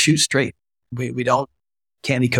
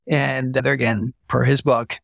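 normal talking voice

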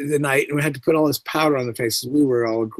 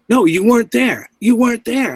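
higher, louder, alarmed demands; frantic questioning; 9 semitones higher, 5 dB louder than usual. Retold or imitated. Young officer who lost sight of him, frantic and loud. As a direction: press him, rattled and urgent.

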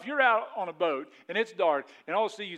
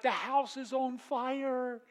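mock alarm; 8 semitones higher, much slower than usual. Acts out disciples' alarm.

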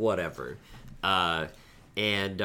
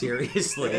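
ordinary talk, nothing marked

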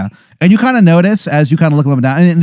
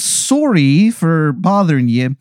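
slow, apologetic bad Canadian accent; Angel of Canada, Canadian accent; 3 semitones higher, slower than usual. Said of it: "it is a horrible Canadian accent".

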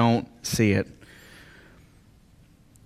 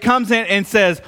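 normal talking voice

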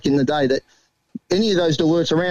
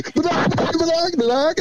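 much higher nonsense babble, imitated; a mate's prank voicemail, much higher babble; mocking Jake's message; 11 semitones higher than usual. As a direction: parrot a silly phone message, pitched way up. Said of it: "He rings me, leaves a message".

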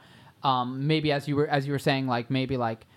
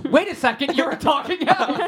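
high, loud startled disbelief; surprised; 12 semitones higher, 6 dB louder than usual. Pretend. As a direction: react like you just noticed the house talks. Cop realizes house talks.

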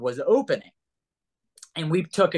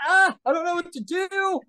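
awkward panic; 13 semitones higher than usual. Retold or imitated. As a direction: blurt it out, socially cornered. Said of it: "I am so socially awkward".

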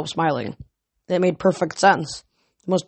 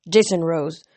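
ordinary delivery, nothing marked

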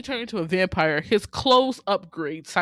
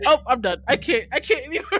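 higher, louder, fast laughing surrender; laughing give-up; 7 semitones higher, 8 dB louder, faster than usual. Retold or imitated. Host giving up on the movie, laughing. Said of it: "I was like".

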